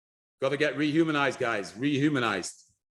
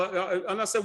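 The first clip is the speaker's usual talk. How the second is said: ordinary delivery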